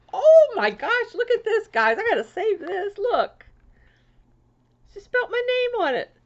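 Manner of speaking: high, loud shrieks of delight; high, loud, thrilled gushing; excited squeal. Real, not acted. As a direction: squeal like you just won a prize. Delighted by a personalized card.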